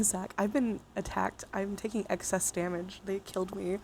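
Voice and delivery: ordinary talk, nothing marked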